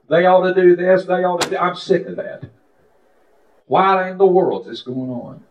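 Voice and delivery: exasperated complaint, acted out; retold angry rant. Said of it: "I was tearing her up".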